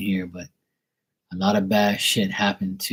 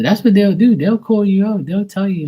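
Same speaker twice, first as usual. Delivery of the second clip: suddenly much higher and louder, near shouting; excited outburst; 10 semitones higher, 6 dB louder than usual. Real, not acted. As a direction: burst out with it, fired up. Excited explanation of spirits' behaviour.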